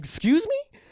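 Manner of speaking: sharp, very high indignant squeal; indignant excuse me. Retold or imitated. Answering the roommate's insult with a high, loud indignant challenge. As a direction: fire it back, outraged and challenging. Roommate calls him little bitch.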